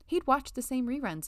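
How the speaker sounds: plain reading voice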